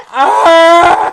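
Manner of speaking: high, loud laughing shriek; panicked scream. Retold or imitated. Fleeing the band girl, high loud panicked shriek. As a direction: shriek and run off. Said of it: "saw a guy walking down the bar".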